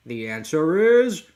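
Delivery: high, loud, drawn-out triumphant cry; over-the-top cartoon announcement; hammed-up character shout. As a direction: blare it out and stretch the last word. Voicing manga character's big entrance.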